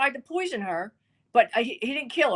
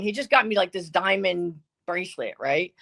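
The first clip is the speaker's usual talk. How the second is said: normal talking voice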